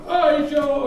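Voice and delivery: high, loud sing-out hail; imitated greeting call. Filipinos hailing three Americans, high loud call. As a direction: call out to foreigners across the street, imitated.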